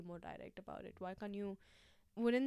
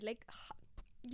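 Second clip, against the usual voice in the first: normal talking voice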